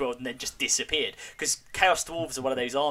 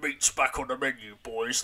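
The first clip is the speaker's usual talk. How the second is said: Tolkien orc voice. Retold or imitated. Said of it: "that kind of orcish way of talking".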